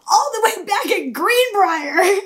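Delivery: high, slow indignant reply. Retold or imitated. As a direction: drag it out in disbelief. Confronting driver retold.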